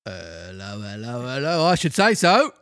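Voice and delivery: police officer finding a puppet on the ground, bobby voice; Victorian bobby. Acted. Carabinier voice.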